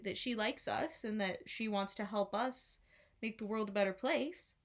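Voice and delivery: ordinary delivery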